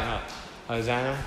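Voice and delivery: soft, low, half-hearted cheer; deliberately lacking gusto. Shown as a demonstration. Preacher showing congregation how not to cry out, half-hearted. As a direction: say it with no conviction at all. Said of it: "Say it with a bit of gusto".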